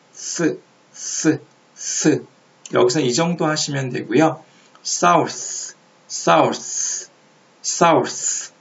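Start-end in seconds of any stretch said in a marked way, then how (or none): 0.1-2.2 스 as English th, tongue tip lightly between teeth; th sound demonstration. Shown as a demonstration. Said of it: "혀끝을 이빨 사이 살짝 끼고". 4.8-8.5 사우스 as English south, tense s and final th; south pronunciation demo. Shown as a demonstration. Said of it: "이렇게 읽으시면 되는데".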